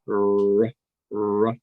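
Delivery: usual speaking voice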